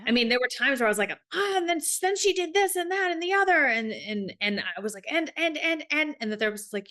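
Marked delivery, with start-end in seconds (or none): 1.3-3.7 high-pitched, worked-up retold rant; agitated venting. Acts out her own agitated venting. 5.1-6.2 agitated venting. Retold or imitated. Acts out her own agitated venting.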